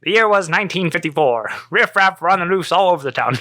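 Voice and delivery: high, mock-dramatic period narrator; hammed-up scene setting from another era; old-time narrator parody. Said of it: "what fucking year is it".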